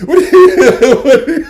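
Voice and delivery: breaking into loud laughter mid-question; laughing. Real, not acted. Husband cracking up retelling his wife's panic. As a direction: laugh through the words. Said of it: "laughing while retelling".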